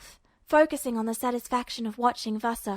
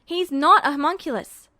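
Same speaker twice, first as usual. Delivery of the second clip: louder, slightly raised plea; a desperate, raised protest; desperate, voice up a notch; 3 semitones higher, 6 dB louder than usual. A narrator's reading. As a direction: plead urgently with rising pitch. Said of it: "voice from going up a notch, desperately".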